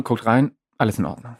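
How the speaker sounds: ordinary delivery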